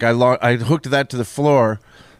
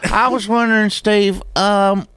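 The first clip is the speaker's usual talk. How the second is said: higher-pitched fake Southern caller voice, hammed up; southern accent; 5 semitones higher than usual. Caller phoning the radio host in a mock Southern accent. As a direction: put on a silly Southern accent for a prank caller. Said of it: "I don't know why I have a southern accent".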